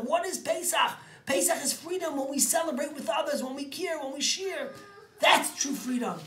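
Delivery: high, loud preaching build; rally the congregation to kindness; fervent high, loud sermon climax. Acted. Closing sermon peroration.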